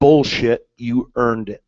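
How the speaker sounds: dry, matter-of-fact repeat; repeating Lefty's dry line on request; deadpan. Retold or imitated. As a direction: say it flat and unimpressed. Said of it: "Could you say that again?".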